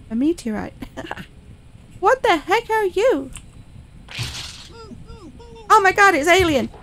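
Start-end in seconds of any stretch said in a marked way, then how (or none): 2.0-6.7 higher startled squeaky question; shrill-voiced clown gawking; high, louder baffled clown. Voicing the clown meeting alien.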